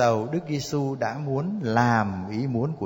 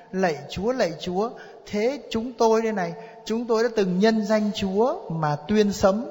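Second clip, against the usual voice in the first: high-pitched, insistent appeal to the Lord; pleading crowd; 6 semitones higher than usual. Acted. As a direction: act out people pleading their credentials on judgment day. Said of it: "nhiều người thưa với Đức Giêsu thế này".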